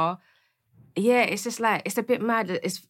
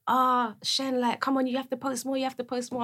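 nagging siblings; 6 semitones higher than usual. Retold or imitated. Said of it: "banging and banging them".

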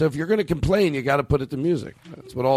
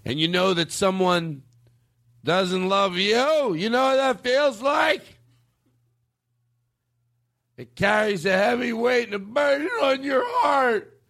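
put-on high, slow drunken wail; angry drunken uncle; 9 semitones higher, slower than usual. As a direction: imitate a drunk uncle building to a drawn-out rant. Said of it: "just complaining and drunk".

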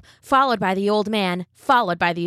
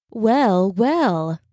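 sly fox. A narrator's reading. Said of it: "said a fox".

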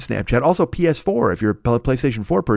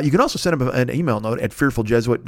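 ordinary talk, nothing marked

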